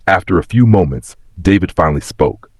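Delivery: ordinary delivery, nothing marked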